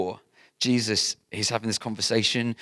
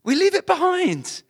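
raised, high preaching cry; loud; 6 dB louder than usual. Real, not acted. Sermon climax, loud.

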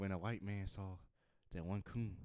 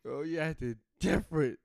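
higher, louder put-on voice; mock alarmed character; 7 semitones higher, 7 dB louder than usual. Comedian plays someone noticing odd behavior, theatrical. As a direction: exclaim with theatrical suspicion. Continuing guard-calling character.

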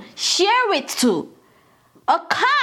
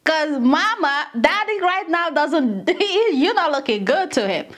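ordinary delivery

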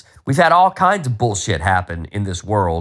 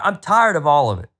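ordinary talk, nothing marked

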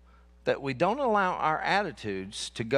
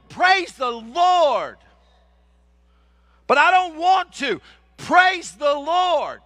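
full-force preaching; 9 semitones higher, 7 dB louder than usual. Real, not acted. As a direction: preach it at full cry, stretching each praise.

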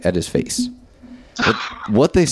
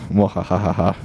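evil laugh. Pretend. Evil laugh after title.

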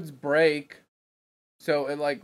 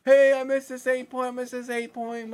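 excited; 9 semitones higher, 4 dB louder than usual. Retold or imitated. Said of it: "already on my phone".

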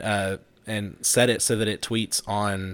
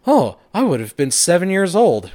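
higher, louder mock-youthful quote; imitating young guy; 7 semitones higher, 7 dB louder than usual. Host retelling the young guy's reply, mock chipper.

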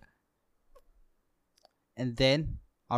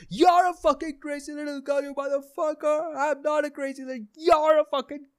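shrill, much higher enraged shouting; screaming at the friend, higher and strained; furious comeback; 13 semitones higher than usual. Retold or imitated. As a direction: explode back with insults. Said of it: "And I'm like".

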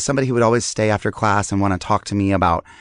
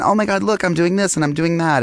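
higher, perky show-off voice, lightly played; mock gushing excitement; 7 semitones higher than usual. Host imitating his own cheery posts, mock gushing excitement. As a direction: gush like a bragging social media post.

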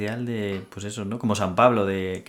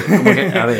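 laughing loudly mid-question; 6 semitones higher, 10 dB louder than usual. Real, not acted. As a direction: laugh through the words, much louder. Both laughing over joke.

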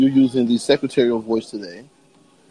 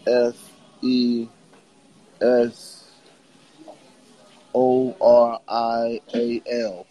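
slow letter-by-letter spelling; much slower than usual. Shown as a demonstration. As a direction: spell each letter out slowly. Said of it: "I will spell it for you now".

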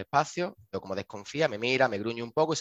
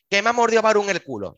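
retold indignant cry, much higher and louder; outraged exclamation; 10 semitones higher, 8 dB louder than usual. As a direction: imitate her blurting out the bite. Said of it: "súper sofocada".

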